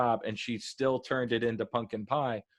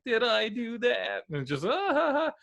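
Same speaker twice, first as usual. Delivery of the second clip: Steve Urkel; 10 semitones higher than usual. Retold or imitated. Imitating Tendi doing Urkel, high goofy squeak. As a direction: squeak it like a goofy TV nerd, then a nasal giggle. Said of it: "full on, like, Steve Urkel".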